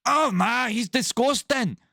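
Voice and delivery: high, drawn-out fake Irish wife; hammed-up high mock Irish voice; muddled accent. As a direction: squeal it in a bad Irish accent. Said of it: "I don't know what l-, accent we're doing here".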